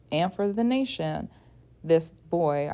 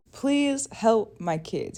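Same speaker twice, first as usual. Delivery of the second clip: slow, loud, higher mock plea; comedic praying mom; 5 semitones higher, 5 dB louder, much slower than usual. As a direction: beg a god for help, lightly hammed up. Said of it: "the mom prays to Zeus".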